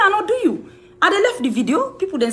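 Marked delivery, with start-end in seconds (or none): 0.0-0.7 high mocking denial. Quoting Henrietta's denial.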